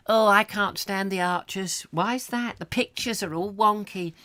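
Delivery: put-on high prim old lady voice; high, prim neighbourly gossip. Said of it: "I've got to get out of character".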